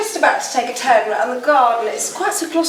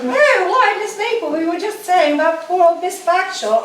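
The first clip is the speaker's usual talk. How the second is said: lightly hammed-up posh English accent with laughs; a hint of an affected upper-class English accent; put-on posh English accent; 2 semitones higher than usual. Accomplice covering with guests, mild put-on posh English accent. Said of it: "that ridiculous accent".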